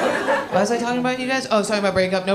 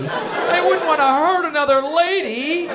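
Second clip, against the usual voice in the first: slow, higher mock-noble voice; mocking imitation; 4 semitones higher than usual. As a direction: parody a reluctant heartbreaker, drawn out. Mocking audience member's stated fear.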